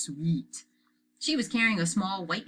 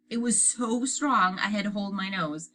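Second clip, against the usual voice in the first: usual speaking voice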